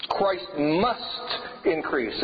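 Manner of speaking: "must" drawn out long, then a break; very slow, lean hard on "must"; stress on must. Shown as a demonstration. Preacher closing out the message, emphatic stress on "must". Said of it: "If I put that emphasis".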